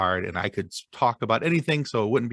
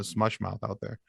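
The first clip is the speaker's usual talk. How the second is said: ordinary talk, nothing marked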